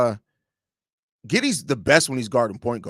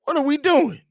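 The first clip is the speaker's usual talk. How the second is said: exasperated question; 12 semitones higher than usual. Real, not acted. Dad to son interrupting.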